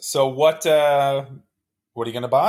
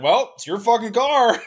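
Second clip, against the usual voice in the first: higher-pitched mock dealer voice; imagined dealer; 8 semitones higher than usual. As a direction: put on a dealer brushing off a customer, pitched up. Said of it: "would have expected you call and be like".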